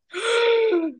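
sharp high gasp of shock; high startled intake of breath. Retold or imitated. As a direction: gasp like you've been caught out. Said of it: "I literally was like".

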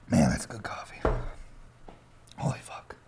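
breathy whisper of delight. Real, not acted. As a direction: whisper it under your breath. Savoring coffee, under breath.

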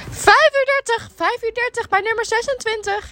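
put-on old lady voice. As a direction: nag it like a bossy old lady. Caller lady voiced in story.

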